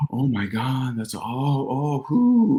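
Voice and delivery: husband mimicking his wife's excitement at the auction; imitating excited wife. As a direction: gush over the prize in a hushed squeal. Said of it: "she's excited".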